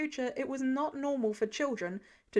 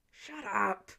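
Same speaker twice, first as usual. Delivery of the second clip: hissed; 4 semitones lower than usual. A narrator's reading. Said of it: "Sam hissed through gritted teeth".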